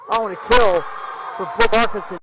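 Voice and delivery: loud, high excited kill call. Real, not acted. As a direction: shout the big point home. Live volleyball play-by-play call.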